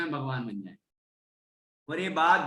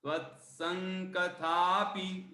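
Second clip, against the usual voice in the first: slow demo; 3 semitones higher, 6 dB quieter, much slower than usual. Shown as a demonstration. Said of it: "त्वत् आदत है ना".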